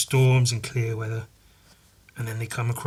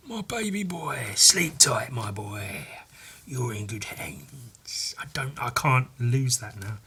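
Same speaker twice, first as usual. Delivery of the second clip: tender whisper; 7 dB quieter, slower than usual. Acted. Voicing tender comic lullaby.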